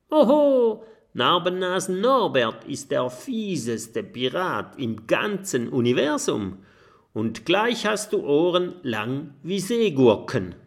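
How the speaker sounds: high, loud mock pirate boast; boasting pirate girl. A narrator's reading. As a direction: brag like the nastiest pirate ever. Mathilda plays a pirate. (hohe, laute Piratenprahlerei im Spiel)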